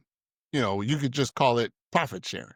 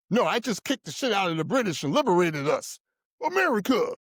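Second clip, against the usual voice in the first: mock patriot; 6 semitones higher than usual. Mocking patriots denying slavery.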